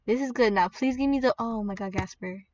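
ordinary talk, nothing marked

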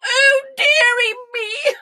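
loud, high, drawn-out wail of a king; mock bawling like a spoilt king; hammed-up sobbing. A narrator's reading. King denied his butter, mock sobbing. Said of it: "The king sobbed".

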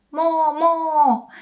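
high mooing mo, mo; cow moo. Shown as a demonstration. Teacher contrasts mo with English moo, mooing. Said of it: "we say mo".

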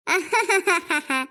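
low, drawn-out chuckle; staged low ha-ha laugh; acted laugh.

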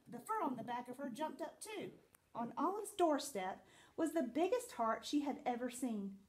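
usual speaking voice

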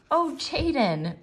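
slow, high, heartfelt cry; mother cries out to her hurting son; cried out. Acted. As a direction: pour her heart into his name. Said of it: "Penny cried out".

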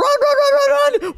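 frantic high yelling; panicked screaming. Real, not acted. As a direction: scream it while running for your life. Fleeing a chasing monster.

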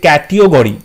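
"ক্যাটিওগরি" said kat-i-o-go-ri; "ক্যাটিওগরি" misread with "tio" in the middle; misspelling read aloud. Shown as a demonstration. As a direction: put an extra "io" into "ক্যাটিওগরি". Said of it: "এটা সঠিক নয়".

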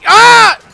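very loud, high scream; short high shriek of alarm; real startled cry; sudden yelp. Real, not acted. Cries out during game.

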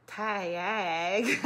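"tag" stretched very slowly into "tay-ag"; dragged-out "tag". Shown as a demonstration. Showing why the lieutenant complained, drawled-out "tag". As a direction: draw "tag" out into two long syllables. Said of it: "I dragged out the".

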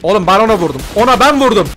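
loud yell, pitch jumping up; shouting. Real, not acted. Claiming the kill to teammates, real loud shout. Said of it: "bir tık bağırmış olabilirim".

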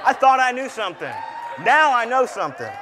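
shouted, high and loud; triumphant preaching shout. Real, not acted. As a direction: belt it out to the congregation. Preaching climax.

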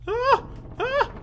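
short very high yelps; startled yelp. Real, not acted. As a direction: yelp twice in quick alarm. Startled in game.

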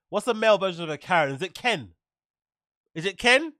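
ordinary delivery

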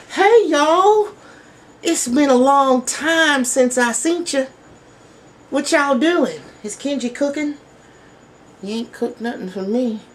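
very high squeaky cartoon bear; high squeaky puppet bear woken from sleep; sleepy teddy bear voice. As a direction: squeak like a groggy stuffed bear. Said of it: "It's Dab the AIDS Bear here".

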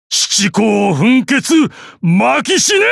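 first words drawn out slowly, then a high roar; battle cry. Acted. Old master unleashes his special move, slow then roaring. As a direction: build from a slow growl into a killing cry.

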